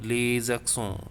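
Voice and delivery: slow "les accents", linked with a Z sound; liaison. Shown as a demonstration. Teacher shows students the liaison, linked Z sound. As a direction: run "les" into "accents" as lay-zak-son. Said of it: "you can see the liaison".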